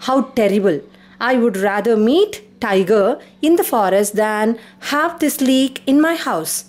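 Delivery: ordinary talk, nothing marked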